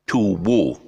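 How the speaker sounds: TWO said as "too-woh"; mispronounced with sounded W. Shown as a demonstration. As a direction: add a loud W syllable to two. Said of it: "It's not".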